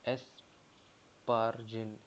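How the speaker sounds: very slow, every syllable of एस्पारजिन drawn out; slow spelled-out name. Shown as a demonstration. Teacher sounding out एस्पारजिन for students, very slow and drawn out. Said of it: "ग्यारहवाँ है एस्पारजिनिन".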